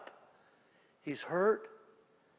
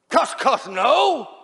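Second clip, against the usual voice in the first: high, loud mock swearing; explosive acted outburst; shouted denial; 10 semitones higher, 16 dB louder, much slower than usual. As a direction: yell pretend curses in denial. Peter cursing in denial.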